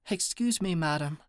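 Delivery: high British lady voice; high-pitched British accent. A narrator's reading. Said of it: "in a high-pitched British accent".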